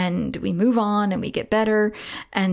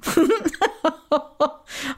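high burst of chuckles; high, knowing laughter; 8 semitones higher than usual. Real, not acted. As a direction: laugh in sympathetic recognition. Amused by the listener's name.

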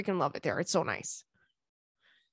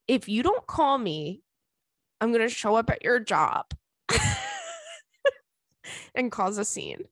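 joking threat. Retold or imitated. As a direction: warn him in measured pieces, then crack up. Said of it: "I threatened him".

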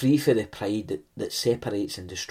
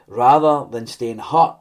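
louder and slow, leaning on hurt; emphatic preaching; 9 dB louder than usual. Acted. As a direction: drive the word hurt home from the pulpit. Preacher pressing home a point.

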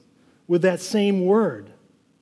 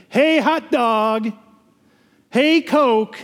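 vendor calling out; 3 semitones higher, much slower than usual. Retold or imitated. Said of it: "concession vendors out there saying".

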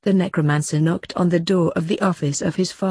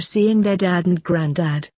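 ordinary delivery

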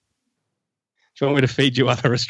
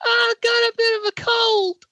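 squeaky high voice. Acted. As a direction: pitch way up like a cartoon. Stays in falsetto character.

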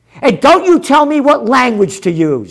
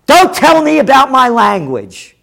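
normal talking voice

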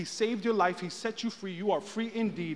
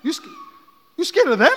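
loud, high-pitched teasing question; hammed-up disbelief, pitched up; mock incredulity; 10 semitones higher, 10 dB louder, faster than usual. Retells teasing her fear.

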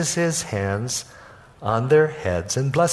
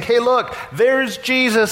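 higher, eager call of parents spotting Jesus; excited parents; 8 semitones higher than usual. Pretend. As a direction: point and call out happily. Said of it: "Imagine they saying".

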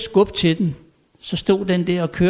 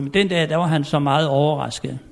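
normal talking voice

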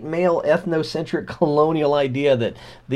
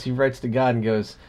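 usual speaking voice

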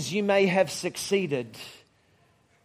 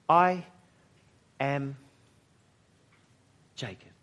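owning up; 4 semitones lower than usual. Acted. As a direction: confess it word by word, dropping low. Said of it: "fesses up".

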